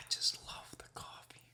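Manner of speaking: soft secret whisper. Real, not acted. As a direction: breathe it out unvoiced.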